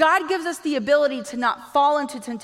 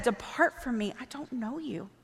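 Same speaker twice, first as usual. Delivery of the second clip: quiet dismissal; 2 semitones lower, 9 dB quieter than usual. Retold or imitated. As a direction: drop to a soft, final dismissal. Quotes Jesus dismissing people.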